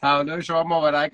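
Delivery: normal talking voice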